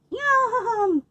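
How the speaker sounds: high, happy little yum; a greedy squirrel relishing it; squirrels' yum. Acted. Squirrels enjoying the stolen seeds, high and happy. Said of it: "said the squirrels".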